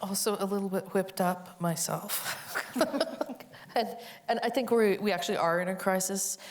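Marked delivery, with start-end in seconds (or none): 2.4-3.7 a short, airy real laugh; breathy laugh. Real, not acted. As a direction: laugh it off under your breath. Laughs at being whipped up.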